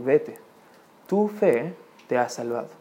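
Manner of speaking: soft, low, solemn answer; quiet pronouncement. Acted. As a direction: pronounce it softly and low, with a pause. Jesus' answer read from scripture. (sentencia solemne, grave y suave)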